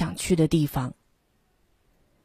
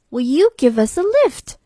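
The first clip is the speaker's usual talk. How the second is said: hopeful sailors; 6 semitones higher than usual. A narrator's reading. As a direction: ask it with a hopeful lift. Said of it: "asked the sailors".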